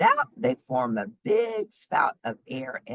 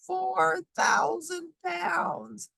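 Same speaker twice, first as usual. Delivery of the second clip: high, slow, drawn out in wonder; amazed emphasis; much slower than usual. Real, not acted. Reading to children about baby whales, slow high amazed emphasis. Stressing a huge number.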